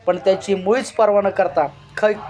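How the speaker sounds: usual speaking voice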